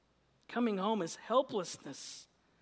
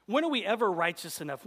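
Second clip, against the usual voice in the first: ordinary talk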